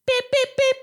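three very high, short beeps; imitated alarm chirp. Imitating the mystery chirping at home, very high beeps. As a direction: squeak out three identical electronic beeps. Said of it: "something's just chirping".

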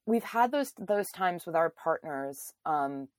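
usual speaking voice

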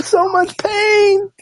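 high, slow, hammed-up sob; mock crying. Friend joking about the sad scene: hammed-up sobbing. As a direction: wail it like a fake cry.

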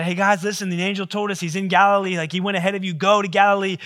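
breathless rush of good news; excited news. Acted. Women telling the disciples about Galilee, excited and loud.

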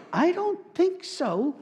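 high, slow, drawn-out disbelief; mock disbelief. Pastor driving past familiar town names, high slow disbelief. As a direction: stretch it out like you can't believe the sign. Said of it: "driving through there going".

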